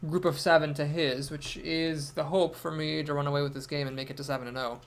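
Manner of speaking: ordinary talk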